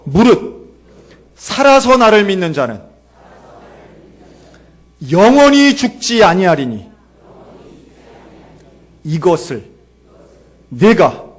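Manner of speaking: loud declamation in short, separated phrases; recitation for repeating. Shown as a demonstration. Preacher leads congregation through Jesus's words, loud phrase-by-phrase declamation. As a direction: proclaim phrase by phrase, pausing after each. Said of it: "한번 따라할까요?".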